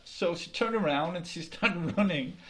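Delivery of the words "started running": plain reading voice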